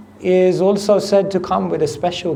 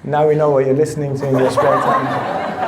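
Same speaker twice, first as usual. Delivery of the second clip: teasing laugh; 3 semitones lower than usual. Real, not acted. Teasing the listener while laughing.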